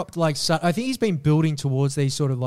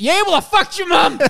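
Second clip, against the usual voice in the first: much higher and louder, yelled; triumphant shout; 8 dB louder than usual. Pretend. Husband firing back at his nagging wife, triumphant shout. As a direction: shout the killer comeback. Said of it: "It's like".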